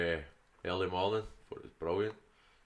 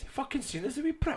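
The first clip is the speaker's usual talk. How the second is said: much higher, indignant outburst; exasperated high outburst. Real, not acted. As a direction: squeal it in disbelief. Exasperated retelling, later said again plainly.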